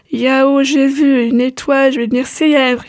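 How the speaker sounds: ordinary delivery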